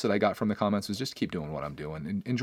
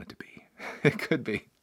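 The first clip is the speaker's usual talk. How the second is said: breathy little whispered aside with a laugh; playful whisper; 6 dB quieter than usual. Real, not acted. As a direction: drop to a secret whisper, amused. Whispered joke about investing podcast.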